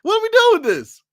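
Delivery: high, incredulous outcry. Real, not acted. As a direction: cry out in disbelief. Repeated outburst, higher.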